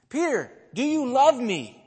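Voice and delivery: Jesus insisting on the third asking; pressing question. Acted. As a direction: push harder on the question. Jesus asks the third time.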